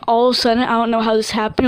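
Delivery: ordinary talk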